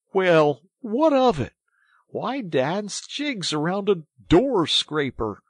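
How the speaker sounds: high, loud, drawn-out complaint; unimpressed scorn. A narrator's reading. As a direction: question a friend's silly excitement. Mole scorns rat's celebrated find.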